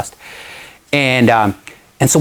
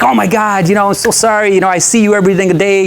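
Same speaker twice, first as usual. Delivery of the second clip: gushing apology; 8 semitones higher than usual. Retold or imitated. Neighbor apologizing, retold.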